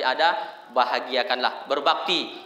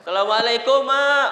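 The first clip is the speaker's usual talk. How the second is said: raised, louder acted greeting; homecoming child; 7 semitones higher, 5 dB louder than usual. Child home to mother after years, calling greeting. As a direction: call out to mother on arriving.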